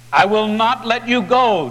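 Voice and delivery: desperate plea. A narrator's reading. Jacob holding on to the stranger, loud pleading. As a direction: beg him not to leave, hard. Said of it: "Jacob says".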